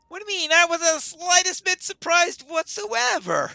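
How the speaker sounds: very high, loud comic protest; hammed-up high-pitched bluster; indignant denial. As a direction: squeal it out, mock-offended. Indignant comic denial of being surprised.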